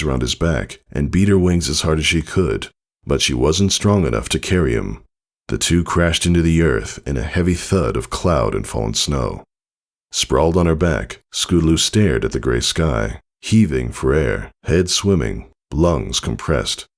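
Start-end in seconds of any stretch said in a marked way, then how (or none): none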